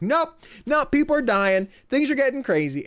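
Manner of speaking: high, loud, gleeful warning; mock alarm. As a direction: pitch it way up as everything goes haywire. Acts out game upending expectations.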